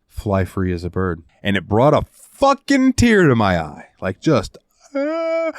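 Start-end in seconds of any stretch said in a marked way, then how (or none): 4.9-5.5 high-pitched mock crying moan; mock wail. Host showing how the ending got to him, mock wail. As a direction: let out a put-on, high teary wail.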